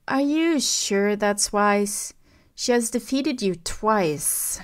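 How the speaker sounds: slow, snide sniveling doubt. A narrator's reading. Officer sniveling doubt at Kylo, slow and snide. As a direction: needle him with a slow sneer. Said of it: "The other man sniveled".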